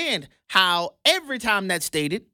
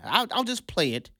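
usual speaking voice

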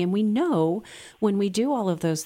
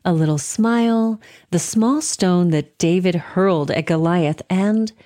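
ad read; 7 dB louder than usual. Acted. Recorded charity ad.

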